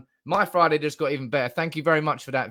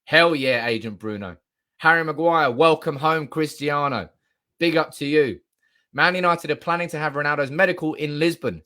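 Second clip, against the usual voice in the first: normal talking voice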